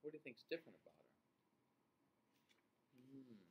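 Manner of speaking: ordinary delivery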